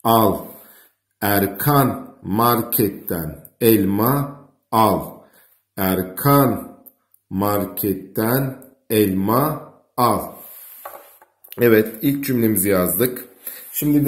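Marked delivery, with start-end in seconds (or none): 0.0-10.3 sounding out. Shown as a demonstration. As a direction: drag each syllable while writing. Teacher sounding out while writing.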